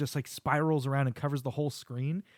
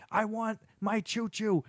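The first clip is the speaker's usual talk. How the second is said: high, slow tantrum demand; mock mad devil; 7 semitones higher, slower than usual. Devil demanding his toy from Santa, playfully mad. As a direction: whine it out like a thwarted devil. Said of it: "the devil's so mad about it".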